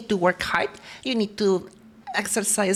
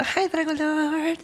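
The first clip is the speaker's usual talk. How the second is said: silly drawn greeting; 7 semitones higher, slower than usual. Pretend.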